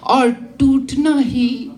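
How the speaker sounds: emphatic closing verse, settling at the end; declaimed Hindi poem; poetry recital. Acted. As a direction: land the poem's message for the audience. Delivers the poem's closing line.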